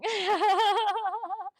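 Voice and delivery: long, high, loud rolling laugh. Real, not acted. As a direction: laugh out loud at your own remark. Laughs at own remark.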